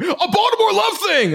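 high-pitched mock fan shout; guy in the crowd. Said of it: "Some guy in the crowd".

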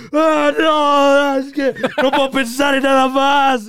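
high, loud, hammed-up crying wail; a fake-dramatic sob to the camera; mock wail. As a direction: overact someone sobbing on camera.

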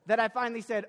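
ordinary talk, nothing marked

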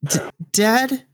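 put-on high, stammering kid voice; scared child. Child telling dad they are scared, high and halting.

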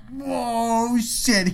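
high-pitched mock dismay; retold alarm. Worker realising trucker overheard, high mock alarm.

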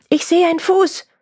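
high, sudden acted shout; calling out. Rescuer spotting the man in the tree, calling out. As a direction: call up the discovery to the others. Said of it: "Plötzlich rief einer".

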